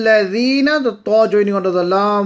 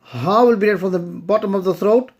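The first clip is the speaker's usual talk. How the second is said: usual speaking voice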